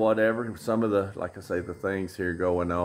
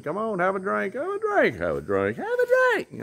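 much higher, singsong coaxing, hammed up; pushy party friends; 14 semitones higher than usual. Friends at the pool bar urging a drink, high mock coaxing. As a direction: mimic friends pushing a drink on him. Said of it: "Everybody said".

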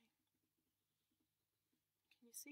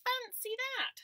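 much higher, breathy purr; sly cat; 12 dB louder, much slower than usual. Acted. Missus Cat enters.

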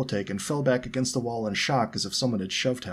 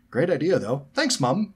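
cheeky; 7 semitones higher, 6 dB louder than usual. A narrator's reading. Twin cheeky quip.

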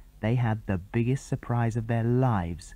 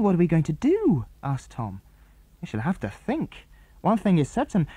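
put-on bright boyish chatter pitched up; boy character voices; 6 semitones higher than usual. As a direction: boys on the island plotting, pitched up. Children alarmed.

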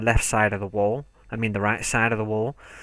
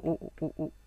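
ordinary delivery

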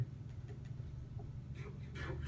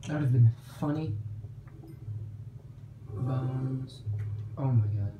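normal talking voice

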